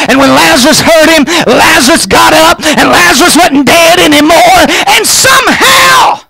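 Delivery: high, driving preacher's shout; full-force preaching. Real, not acted. Preacher tells church that Lazarus got up, full-force preaching.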